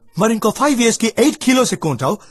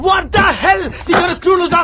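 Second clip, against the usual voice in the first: higher, sharp what the hell; indignant outburst; 8 semitones higher than usual. Acted. Woman snapping at the rude man, high indignant outburst. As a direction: burst out in disbelief. Angry outburst at his rudeness.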